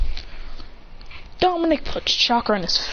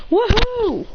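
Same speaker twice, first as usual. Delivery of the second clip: high, thrilled whoop; gleeful whoop; 12 semitones higher, 4 dB louder than usual. Acted. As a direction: whoop it out in a raised pitch. Character cheers while flying.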